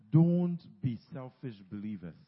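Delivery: normal talking voice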